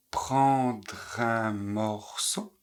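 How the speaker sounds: je vais prendre, very slow and separated; slow syllable drill. Shown as a demonstration. Teacher drilling students, very slowly. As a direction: stretch each syllable out slowly. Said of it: "vous les répétez exactement comme je les prononce".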